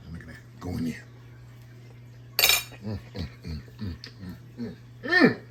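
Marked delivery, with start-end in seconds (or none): 2.8-5.4 mm of delight. Real, not acted. Tasting the fried tomato.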